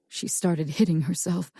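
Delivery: plain reading voice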